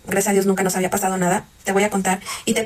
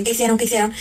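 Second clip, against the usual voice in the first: ordinary talk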